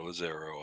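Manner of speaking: Lazaro with the stress on the second syllable, la-ZAR-o; Lazaro, mildly imitating la-ZAR-o; announcer's mispronunciation. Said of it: "put the emphasis on the second syllable".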